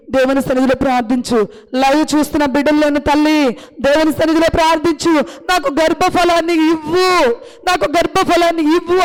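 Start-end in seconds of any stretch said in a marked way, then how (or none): 5.5-9.0 pleading prayer line shown to the mother; modelled prayer. Acted. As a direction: ask like a child asking a father. (వేడుకోలు ప్రార్థన)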